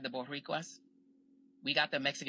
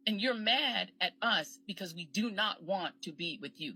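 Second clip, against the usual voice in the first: usual speaking voice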